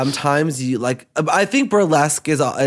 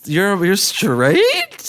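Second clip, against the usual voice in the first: mock disbelief; 6 semitones higher, much slower than usual. Mocking gays' reaction, acted quote.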